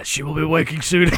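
put-on gruff hero voice, breaking into laughter; mock a gruff comic-book hero; gruff Claudio. Co-host teasing with the monologue's first line, mock gruff. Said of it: "Claudio Gruff voice".

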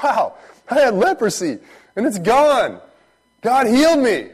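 high, loud, amazed outburst; overjoyed, booming testimony; excited. Acted. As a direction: shout it out like a man just healed. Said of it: "this boy was excited".

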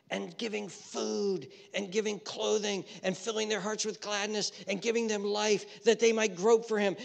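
loud, high impassioned preaching as God pleading; preaching at full force. Acted. Preacher voicing God to sinners, impassioned shout. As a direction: cry out God's plea to the people.

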